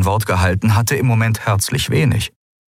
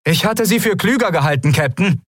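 called out; 11 semitones higher than usual. Acted. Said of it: "als sie rief".

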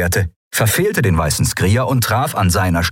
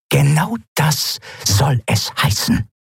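slow, higher and hissed; hissing captain; 7 semitones higher, slower than usual. Acted. Captain hissing at her mate, slow and cold. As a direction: spit the words at him. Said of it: "fauchte Zaylar".

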